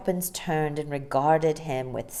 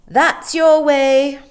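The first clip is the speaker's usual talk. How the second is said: slow, loud curt dismissal; short; 10 semitones higher, 14 dB louder, much slower than usual. Acted. Nanny curtly sending the boy home alone. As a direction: cut him off, pointing the way. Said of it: "said Mary Poppins shortly".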